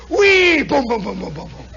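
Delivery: high loud whine into tumbling booms; squeal, then booms fading away; plane crash noise. Retold or imitated. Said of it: "suddenly one goes".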